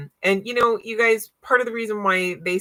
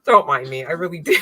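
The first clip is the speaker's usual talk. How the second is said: ordinary delivery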